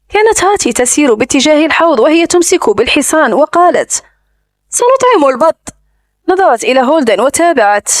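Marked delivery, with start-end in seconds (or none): 4.7-5.7 lightly voiced eager child; a hint of a little girl's voice. A narrator's reading. Child announces feeding the ducks. (صوت طفلة بلمسة خفيفة)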